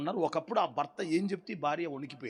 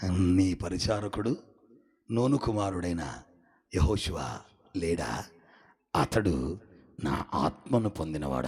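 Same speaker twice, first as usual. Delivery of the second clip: lower, slow, solemn divine voice; lowered, measured voice of God answering Moses; 9 semitones lower, 3 dB louder, slower than usual. Retold or imitated. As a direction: speak it slowly as God himself. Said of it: "అప్పుడు దేవుడు అన్నాడు".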